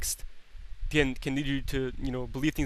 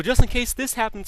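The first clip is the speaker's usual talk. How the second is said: lightly voiced fretting mom, higher pitch; worrying mother; 7 semitones higher, 4 dB louder than usual. Overcareful mom warning her kid, worrying mother.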